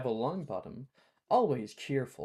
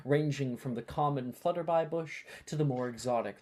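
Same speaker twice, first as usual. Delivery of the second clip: usual speaking voice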